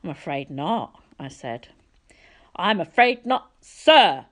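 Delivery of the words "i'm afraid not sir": higher, louder, stressing sir; a pompous director demanding to be called sir; haughty correction. A narrator's reading. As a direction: correct the boy's manners loftily.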